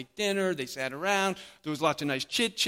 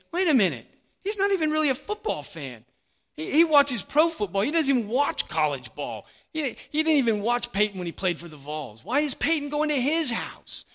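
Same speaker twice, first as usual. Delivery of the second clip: high, louder mock complaint; grumbling crowd; 7 semitones higher, 4 dB louder than usual. The crowd complains about Peyton's choice, in a high mock whine. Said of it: "the crowd would be saying".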